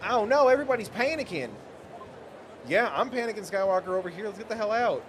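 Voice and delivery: high, loud panicked voice. Acted. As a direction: panic and rush out. Panicking character.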